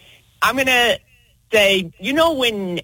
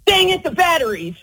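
higher, exasperated outburst; frustrated exclamation; 7 semitones higher than usual. Shown as a demonstration. Listener shows how she'd say the line, annoyed. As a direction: blurt it like you just noticed. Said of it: "what do you say and how do you say it".

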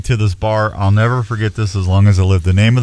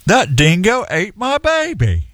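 slow, high Australian-ish movie quote; movie line; 10 semitones higher than usual. Retold or imitated. Host quoting the dingo movie, slow, high mock Australian. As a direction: ham up the famous line slowly. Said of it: "Dingo boots".

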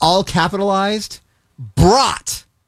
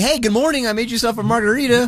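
chirpy, much higher voice, all innocence; mock burglar greeting; 9 semitones higher than usual. Cohost voicing the burglar to the woman, higher and sweet. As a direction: sweetly offer the intruder's margarita, pitched way up.

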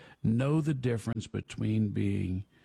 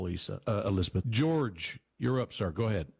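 ordinary talk

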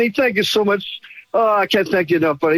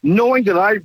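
ordinary talk

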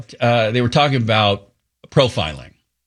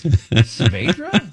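squinting disbelief; 4 semitones higher, much slower than usual. Retold or imitated. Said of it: "she squinted her eyes".

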